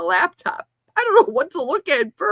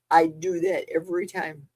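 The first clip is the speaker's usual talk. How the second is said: ordinary talk, nothing marked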